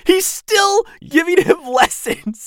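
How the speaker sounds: high, loud, laughing through the words; amused disbelief. Real, not acted. Fan delighted Obi-Wan is still teaching him, laughing disbelief.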